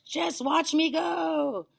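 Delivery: very high, drawn-out holler; triumphant call. A narrator's reading. Grandma calling down to boy, triumphant holler. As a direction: holler it slowly from above. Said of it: "she called down to George".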